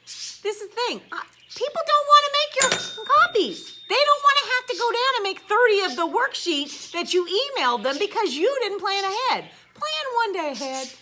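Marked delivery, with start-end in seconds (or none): 1.1-10.9 higher, louder exasperated complaint; fed-up teacher rant. Real, not acted. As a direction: vent the frustration up high. Teacher rant about copies.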